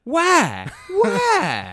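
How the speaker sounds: very high, loud squeaky why; mock squeal. Echoing the coworker's question, squeaky and loud.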